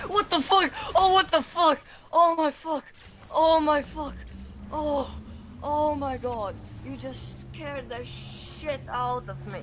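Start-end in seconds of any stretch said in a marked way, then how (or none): 0.1-6.5 startled shouting. Real, not acted. Startled by enemy in game.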